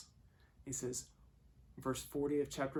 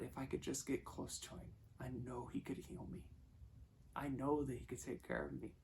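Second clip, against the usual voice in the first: softly voiced private hope; hushed thinking aloud; quiet inner thought; 9 dB quieter than usual. Woman's private thought, retold.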